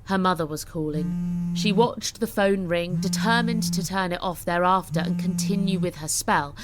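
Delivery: plain reading voice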